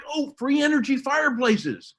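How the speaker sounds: a hint of mocking awe; mock gullible believer. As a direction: play the wide-eyed believer. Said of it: "they're like".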